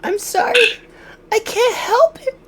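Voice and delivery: very high squeaky voice; rubber duck voice. Acted. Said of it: "talk like a rubber ducky".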